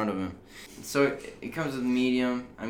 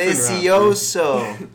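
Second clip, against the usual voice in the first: slow, loud, relishing delicioso; savouring delicioso; 8 semitones higher, 11 dB louder, slower than usual. Pretend. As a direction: sell the taste like an ad. Mock sponsor taste.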